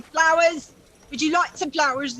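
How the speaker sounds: calling out flowers to passersby; flower seller calling. Acted. Flower seller calling to the street, mild hawker's call. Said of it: "she's just going".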